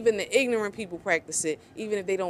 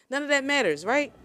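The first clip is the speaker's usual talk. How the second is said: usual speaking voice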